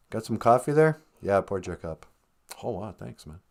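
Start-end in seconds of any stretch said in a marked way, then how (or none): none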